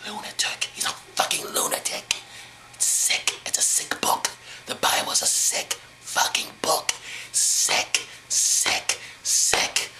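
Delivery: whispered, soft and slow; disgusted whisper. Real, not acted. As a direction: hiss your disgust in a whisper. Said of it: "whispered disgust".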